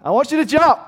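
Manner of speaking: father calling son to jump off the rail. Retold or imitated. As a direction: shout encouragement up to the boy. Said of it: "one of these numbers".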